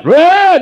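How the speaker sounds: loud, high mock holler; imitated yell. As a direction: mindless testosterone-fueled yell. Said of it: "they're yelling at each other".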